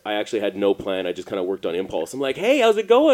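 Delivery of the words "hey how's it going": high, loud mock hello; hammed-up, high-pitched hail; overeager greeting. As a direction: act out an over-the-top friendly greeting. Retold overeager greeting to strangers.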